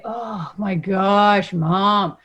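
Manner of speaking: drawn-out, very slow eye-rolling whine; bored kid voice. Retold or imitated. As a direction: sigh it out like a kid who's heard it a hundred times. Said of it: "bored voice".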